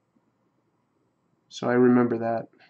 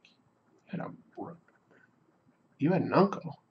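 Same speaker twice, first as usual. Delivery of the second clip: hushed, disbelieving whisper; whispered disbelief at grandfather's news in a dream; stunned whisper; 2 semitones higher, 10 dB quieter than usual. Retold or imitated. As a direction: breathe it out in quiet shock. Shocked reply to grandfather in dream.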